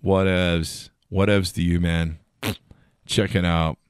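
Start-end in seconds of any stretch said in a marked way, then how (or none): none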